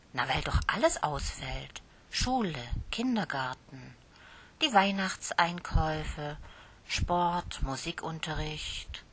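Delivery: ordinary delivery, nothing marked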